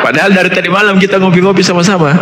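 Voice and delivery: laughing through the words, high and fast; half-laughing disbelief. Real, not acted. Laughing while telling sudden death.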